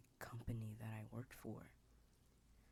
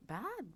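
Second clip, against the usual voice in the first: "bad." jumps much higher and louder; stress on bad; 7 dB louder than usual. Describing the company's dark work, punching "bad." high. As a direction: hit "bad." hard and high. Dramatic emphasis in lore.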